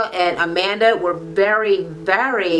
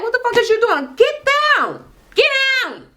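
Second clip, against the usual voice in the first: much higher furious fatherly yell; angry dad yell; 12 semitones higher than usual. Retold or imitated. Retelling the dad catching her twerking, angry yell. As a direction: bark it like a father storming in.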